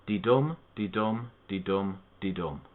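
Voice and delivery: slow de-dum, weak then strong; iambic beat. Shown as a demonstration. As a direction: tap out the iambic rhythm, de-dum. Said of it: "Iambic tetrameter is very similar".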